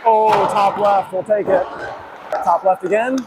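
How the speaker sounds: normal talking voice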